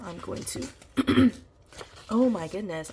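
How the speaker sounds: usual speaking voice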